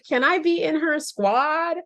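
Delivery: high, excited begging; gushing fan plea. Real, not acted. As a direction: ask it like a giddy fan. Gushing fan plea amid laughter.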